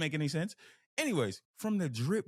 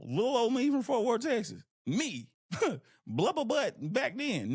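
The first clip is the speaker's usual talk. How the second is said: higher, louder, laughing amazement; excited disbelief; 9 semitones higher, 3 dB louder than usual. Real, not acted. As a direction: marvel out loud at how far you came, laughing. Excited disbelief at own journey.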